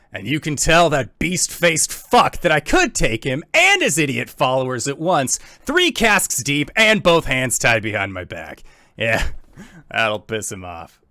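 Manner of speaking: loud, high gruff tavern brawler boast; gruffer voice. Acted. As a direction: bellow it like a drunk bar bruiser. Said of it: "you hear a gruffer voice say".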